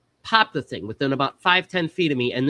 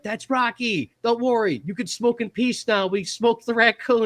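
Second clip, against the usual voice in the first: much higher, bouncy mock cheer; cheerful reassurance; 9 semitones higher than usual. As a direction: tell him it's fine, way up high. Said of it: "And I'm like".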